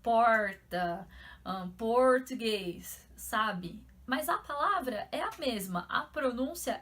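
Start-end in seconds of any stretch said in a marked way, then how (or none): 0.0-1.1 porta with a rolled, hammed-up countryside R; imitating countryside cousins on porta; countryside R accent. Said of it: "o R deles ainda é interior". 1.7-3.0 português said slowly and drawn out, in a mock countryside R accent. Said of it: "o R deles ainda é interior".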